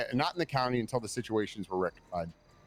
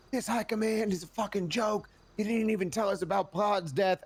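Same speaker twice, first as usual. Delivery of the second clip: much higher, mock outraged complaint; retold yelling; 8 semitones higher than usual. Viv complaining about high command, imitated with mock outrage. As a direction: imitate a fed-up officer venting. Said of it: "apologize for yelling at me".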